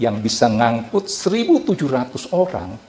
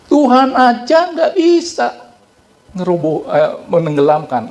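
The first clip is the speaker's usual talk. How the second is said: higher, louder, slow scoffing boast; arrogant boast; 9 semitones higher, 4 dB louder than usual. Retold or imitated. Preacher echoes the shipbuilder's boast, slow and arrogant. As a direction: sneer it out slowly.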